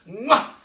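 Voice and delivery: big smacking kiss, high and loud. Acted. Winifred kissing the monster, a loud smacking kiss. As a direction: plant a loud cartoon kiss.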